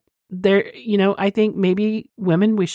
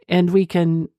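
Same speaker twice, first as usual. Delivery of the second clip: usual speaking voice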